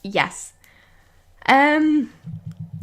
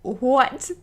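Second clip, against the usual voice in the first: ordinary talk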